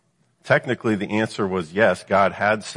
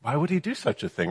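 higher, softer questioning full of doubt; crafty insinuation; 6 semitones higher, 5 dB quieter, faster than usual. Acted. Devil making the woman doubt God's motive, crafty insinuation. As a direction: sow suspicion with a lifted, incredulous question. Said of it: "You see the craftiness in it?".

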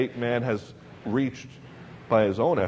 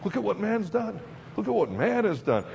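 mock tribute; 7 semitones higher than usual. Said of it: "a continual tribute to man".

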